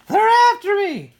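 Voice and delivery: very high, very loud terrified scream. A narrator's reading. Little sister waking from nightmare, terrified scream. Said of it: "woke up screaming".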